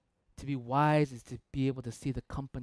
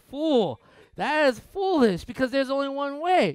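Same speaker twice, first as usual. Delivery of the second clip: mock outrage; 10 semitones higher, 8 dB louder than usual. Mock outburst at his wife.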